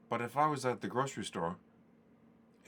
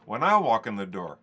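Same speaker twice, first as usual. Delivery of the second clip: mock indignant; 8 semitones higher, 9 dB louder than usual. Mock complaint to stepbrother.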